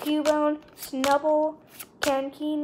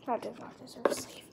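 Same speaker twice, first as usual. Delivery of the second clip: whisper; 11 dB quieter, faster than usual. Real, not acted. Hushed aside about a card.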